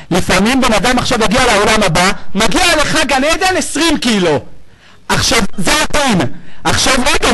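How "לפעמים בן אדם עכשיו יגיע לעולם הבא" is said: normal talking voice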